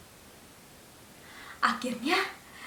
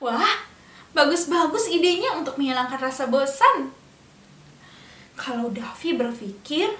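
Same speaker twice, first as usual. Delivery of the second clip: ordinary delivery, nothing marked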